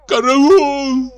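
high, loud scream for help; terrified cry. A narrator's reading. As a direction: yell for help in panic. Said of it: "закричал он". (в ужасе, громко)

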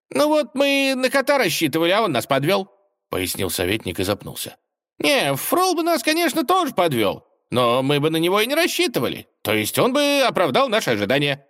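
high-pitched advisor. Acted.

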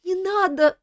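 high, tearful begging cry; girl sobbing to the king; sobbing plea. Acted. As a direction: sob the words out in fear. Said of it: "всхлипнула она".

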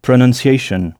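pronunciation with English sounds, not French; English-style pronunciation. Shown as a demonstration. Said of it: "à l'exception de leur prononciation".

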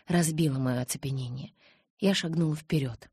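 ordinary delivery, nothing marked

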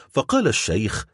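ordinary delivery

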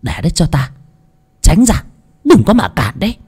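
high-pitched, curt order from the spirit medium; commanding medium's call. A narrator's reading. Medium clearing her way into the house, high commanding call.

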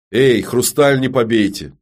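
loud, drawn-out warning call. Acted. Shopkeeper calls to back room.

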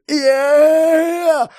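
drawn-out high-pitched scream; sustained scream of terror; long high yell. A narrator's reading. Hero bracing for the lightning, long high scream. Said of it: "ору в мыслях".

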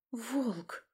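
soft, stunned exhalation; hushed gasp of realisation; breathed out. A narrator's reading. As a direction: breathe the word out in dread. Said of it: "выдохнула я".

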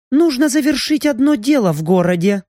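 a lightly voiced sorceress; female mage voice. Raina the mage speaks. (голос магички)

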